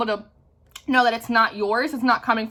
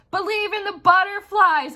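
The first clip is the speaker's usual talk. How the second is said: urging movie hero; 8 semitones higher than usual. Retold or imitated. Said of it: "he's like".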